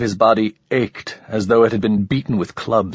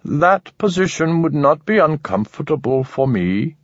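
a curious creature commenting coolly; alien voice; 6 semitones higher than usual. A narrator's reading. Narrator voices alien Manek.

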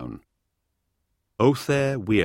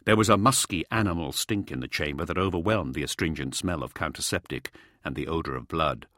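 plain reading voice

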